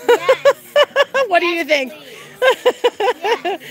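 real laughing excitement; excited yes. Real, not acted. Kids cheer about bunnies.

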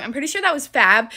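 ordinary talk

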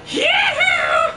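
high loud Yahoo yell; scream. Shown as a demonstration. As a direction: let out a huge whooping scream. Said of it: "I have to do the scream properly".

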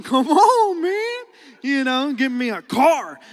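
hopeful pleading. Acted. Grandson silently begging his rich grandpa, hopeful pleading. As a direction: let it climb on "man", angling for a favour. Hoping grandpa buys him car.

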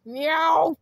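shouted crying meow. Acted. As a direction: wail a meow. Crying cat noise.

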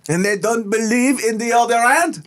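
put-on high, slow hammy French accent; overdone French accent, pitched high; fake French accent. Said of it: "Fake French".

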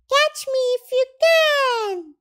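put-on very high childlike call; Roo calling. A narrator's reading. Roo races Tigger down the path, calling out. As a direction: squeal it out as a playful dare. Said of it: "Roo called".

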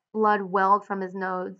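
plain reading voice

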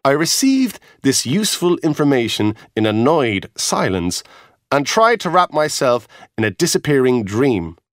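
ordinary delivery

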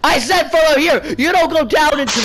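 loud, high-pitched scolding; yelling. Real, not acted. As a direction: yell orders at another player. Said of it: "Stop yelling at me".

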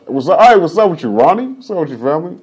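high, loud shout-out opener; hype greeting. Acted. Host mimicking his podcast greeting to guests, high and loud. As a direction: call it out like a show intro.

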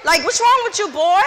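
shouted high and loud at a cheeky son; loud, furious mother's reprimand; angry scolding. Retold or imitated. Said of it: "I read up".

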